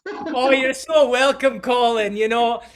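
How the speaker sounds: loud, high put-on Scottish accent. Host introducing guest in a mock Scottish accent. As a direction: bold mock Scottish brogue, hamming it up. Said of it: "I can do my Scottish accent".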